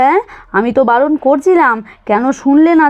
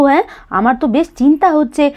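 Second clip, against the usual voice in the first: ordinary talk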